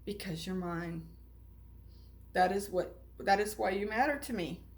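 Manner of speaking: low, soft reassurance; tender and soft. Acted. Eli tells Punchinello why he matters, soft and tender. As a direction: hold him close with the words. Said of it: "spoke softly".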